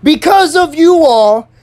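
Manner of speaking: high, slow accusation. Retold or imitated. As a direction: imitate the principal raising her voice. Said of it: "She literally raised her voice".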